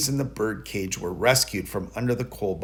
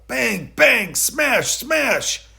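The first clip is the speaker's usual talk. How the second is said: much higher, louder bang bang smash; smashing sounds; 12 semitones higher, 6 dB louder, much slower than usual. A narrator's reading. Narrator acting out Tom Thumb smashing the ham, much higher and louder. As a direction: shout the crashes with gusto.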